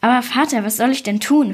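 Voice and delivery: ordinary delivery, nothing marked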